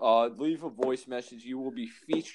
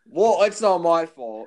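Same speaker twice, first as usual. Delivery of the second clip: loud, higher-pitched self-defence; loud protest; 4 semitones higher, 10 dB louder than usual. Real, not acted. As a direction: protest loudly that you're not to blame. Defending himself against co-host's complaint.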